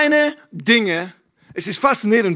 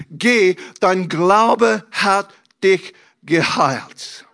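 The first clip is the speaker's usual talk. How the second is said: slow; much slower than usual. Retold or imitated. Jesus heals the blind man.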